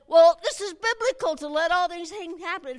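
mock the president's words; mocking quote.

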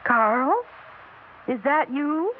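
calls out into the dark house, rising at the end; a hopeful call upstairs. Acted.

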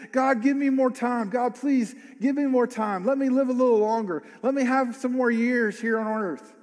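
lightly voiced pleading, half sung; a mild imitation of a desperate prayer; king's plea to God. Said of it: "he starts crying out to God".

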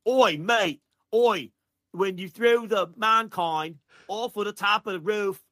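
cartoon Australian accent, drawn out slow; mock Australian accent. Podcast host mocking a wrestler in Australian accent. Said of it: "horrifically out of date ... Australia accent".

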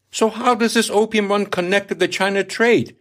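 put-on a curious young lad asking his captain; young Higgins asking. A narrator's reading. Young sailor questions his captain, lightly voiced eager asking.

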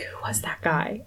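whispered, discreet asking; hushed question. Acted. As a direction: whisper it as Juliet to the nurse. Juliet discreetly asks her nurse.

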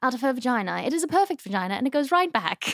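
mock-proper royal English accent; Prince William. Prince praising his wife after birth, mock-royal English accent. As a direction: stretch key words in princely English. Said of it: "do you like Prince William".

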